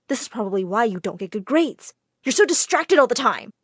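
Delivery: plain reading voice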